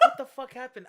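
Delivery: half-laughing shock at his own fall; laughing disbelief. Retold or imitated. Retelling the fall to friends, laughing. Waking up after falling, laughing.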